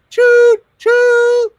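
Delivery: loud, high choo-choo; mock train whistle. Hopping on the superhero train, playful loud whistle hoot.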